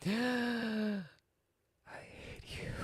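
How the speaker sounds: high then whispered mock hatred; jokingly whispering hatred at the landlord over the music; hissed whisper. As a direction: breathe out a joking I hate you. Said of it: "And I'm like".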